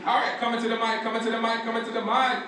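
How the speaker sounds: high, chanted hype on a repeated phrase; emcee call-up. Real, not acted. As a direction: call the next speaker up, pitched high. Host calls next speaker up.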